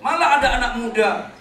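loud, high spelling of B E B A L; loud spelled rebuke. Acted. Pastor rebuking the congregation, loud spelling. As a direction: shout the letters to drive it home. Preacher spells bebal loudly.

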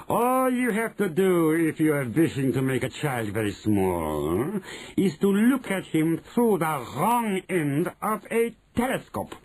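high, drawn-out witch voice; Grand High Witch, self-important. Acted. Grand High Witch teaching telescope trick, self-important. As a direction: spell out the trick with slow relish.

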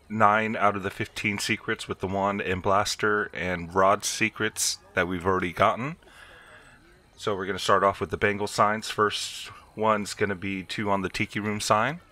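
normal talking voice

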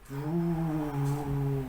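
a ghostly drawn-out hum; eerie hoot again. A narrator's reading. The strange sound repeating in silence, soft eerie hoot. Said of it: "Знову пролунало".

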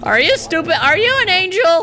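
high, sing-song mock cooing Are you stupid; mock sweet. Gamer teasing an on-screen character, mock-sweet and high. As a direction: tease the character in a sugary high voice.

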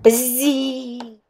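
the fly buzzes the name; buzzing fly voice. Acted. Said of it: "Fly Guy said".

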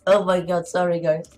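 usual speaking voice

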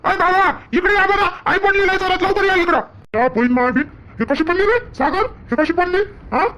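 high, urgent voice calling for help; alarmed son. Acted. Son finds his fallen mother and calls father, alarmed high voice. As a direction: panic as you rush to her.

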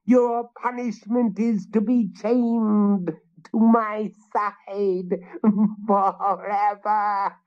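high hag with a cackle, slow on forever; furious witch. A narrator's reading. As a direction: gloat over his doom. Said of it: "turning on Willem with fury".

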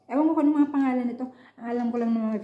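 speaking between bites of fish; talking while chewing. Real, not acted. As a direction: mouth partly full of food. Seen 0: lips pursed, jaw working after bites at -3 and -2; fish handled.

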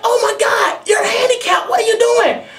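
high shocked outcry at the driver; shocked shout. Retold or imitated. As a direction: yell in stunned outrage. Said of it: "I was like".

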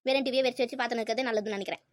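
normal talking voice

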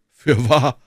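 put-on emphatic young gallant calling out; exclamation. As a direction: exclaim it with force. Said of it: "rief D'Artagnan".

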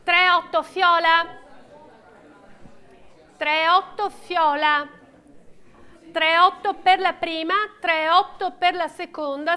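steady rhythmic bid calling; auctioneer calling bids. Real, not acted. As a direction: call out each bid to the room.

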